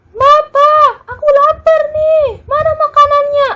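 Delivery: put-on high, loud whiny kid yell; yelling hungry child. Hungry child yelling at papa for food, high whiny yell. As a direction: whine like a starving kid demanding food. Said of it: "teriak-teriak".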